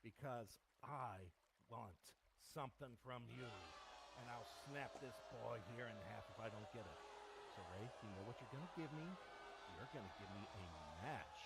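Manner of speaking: soft threatening voice; quiet menace. Acted. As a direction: threaten him calmly and quietly. Villain makes a quiet threat.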